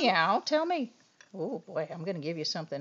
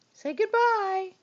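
higher, slower sing-song prompt; 12 semitones higher, 4 dB louder than usual. Real, not acted. Owner asking her dog to say goodbye, slow sing-song. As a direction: stretch it out high for the dog.

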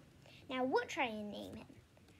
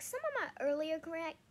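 plain reading voice

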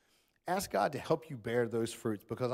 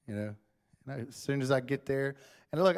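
ordinary talk

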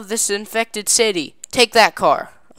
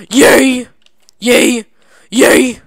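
excited cheering; 3 semitones higher, 8 dB louder, much slower than usual. Real, not acted. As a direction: cheer every landing. Cheering each successful jump.

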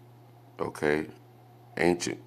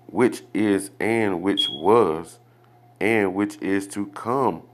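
slow, weighty scripture reading, a little higher; slow solemn reading; 4 semitones higher, 3 dB louder, slower than usual. Acted. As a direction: let each word land like scripture. Reads the scripture slowly and solemnly.

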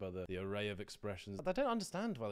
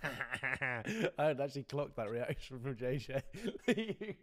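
laughing; 3 semitones higher, 4 dB louder, much slower than usual. Real, not acted. Cracks up watching the replay.

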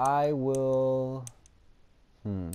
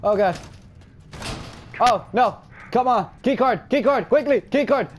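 much higher, louder frantic orders; desperate high shouting; panicked yelling; 11 semitones higher, 6 dB louder than usual. Real, not acted. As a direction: urge yourself on screaming. Frantic escape, door.

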